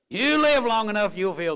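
loud, high preacher's warning; emphatic warning. Real, not acted. Preacher warning young people, loud and emphatic. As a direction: raise it sharply to drive the warning home.

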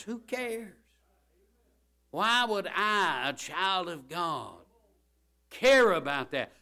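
high, slow indignant question; emphatic preaching. Acted. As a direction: drag out the why in disbelief. Preaching at full force.

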